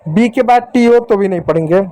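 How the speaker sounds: normal talking voice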